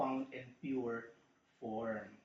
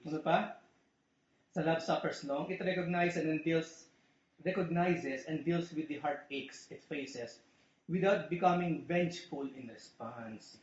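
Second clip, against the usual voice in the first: ordinary talk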